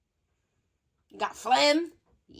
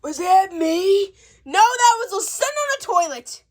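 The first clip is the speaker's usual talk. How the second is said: high, loud giggling words; laughing; 8 semitones higher, 5 dB louder than usual. Acted. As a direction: laugh through it. Laughing at a noise.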